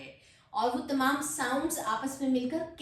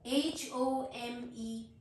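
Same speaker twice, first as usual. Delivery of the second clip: slow letter-by-letter spelling; much slower than usual. Shown as a demonstration. Teacher spelling a word for learners, slow spelling. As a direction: spell it out, a small gap after each letter. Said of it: "letter spell करती हूँ".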